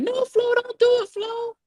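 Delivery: very high-pitched panicked scream; screaming plea. A narrator's reading. Mom begging her sister not to go, screaming. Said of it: "My mom started screaming".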